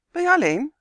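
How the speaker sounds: loud, high called question; calling out. A narrator's reading. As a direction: call it out sharply and high. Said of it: "riep Tummy".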